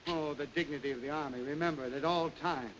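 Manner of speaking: ordinary talk, nothing marked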